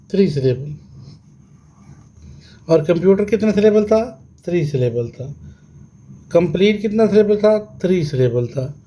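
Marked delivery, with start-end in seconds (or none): none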